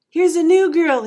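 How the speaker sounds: put-on high, very loud kid's holler; loud announcement. A narrator's reading. Boy announces the new girl to the teacher, very loudly. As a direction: announce it to startle the whole room. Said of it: "he announced so loudly".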